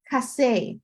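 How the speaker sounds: Cassé with a k sound, falling at the end; Cassé said apart for learners; French hard C. Shown as a demonstration. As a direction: model "Cassé" as a French word. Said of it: "When we're looking at C and K".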